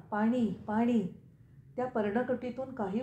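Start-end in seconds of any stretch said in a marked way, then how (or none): 0.1-1.2 mild, drawn-out narrated cry for water; parched cry. A narrator's reading. Thirsty king calling into a hermit's hut, mild parched cry. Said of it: "शुष्क कंठाने तो ओरडला".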